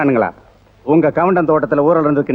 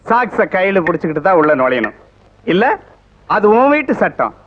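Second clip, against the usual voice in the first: ordinary talk, nothing marked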